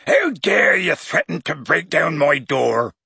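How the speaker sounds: high-pitched indignant roar. A narrator's reading. Hagrid berates Harry over his door, roaring. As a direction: rage at the insolent pupil. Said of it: "he roared at Harry".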